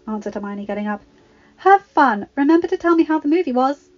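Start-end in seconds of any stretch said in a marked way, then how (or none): 1.6-3.9 higher, louder, called from another room; calling out. A narrator's reading. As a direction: call after the teens from the kitchen. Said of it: "called Missus Figg from the kitchen".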